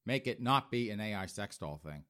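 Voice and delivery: usual speaking voice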